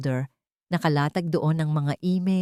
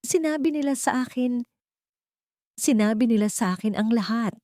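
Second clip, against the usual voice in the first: pained and confused; 7 semitones higher than usual. A narrator's reading. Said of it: "Ang boses ay puno ng sakit at pagkalito".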